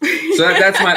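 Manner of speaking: high laugh breaking into words; laughing through the words. Real, not acted. Laughing after joke.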